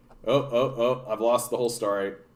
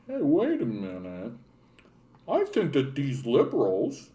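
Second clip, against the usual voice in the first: dim realization; 5 dB quieter than usual. Retold or imitated. Streamer mocks voters finally realizing the truth, soft dopey voice. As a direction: act like it just dawned on you.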